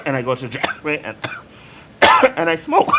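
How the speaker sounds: choking coughs breaking into the talk; coughing. Real, not acted. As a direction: try to keep talking through a coughing fit. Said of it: "He's choking".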